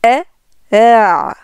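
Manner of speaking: "eh, eh" with the second one left open, no glottal closure; attenuated second hamza. Shown as a demonstration. Teacher showing the two hamzas, second "eh" softened and open. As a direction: ease into the second "eh" with open vocal cords. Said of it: "laisser les cordes vocales ouvertes".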